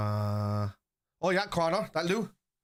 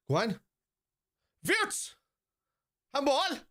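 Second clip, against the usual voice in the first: high, sharp outcry; excited shout; 14 semitones higher, much slower than usual. Real, not acted. Streamer shouting a handball appeal at the screen, excited. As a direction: yelp it out at the screen. Reacting to match live.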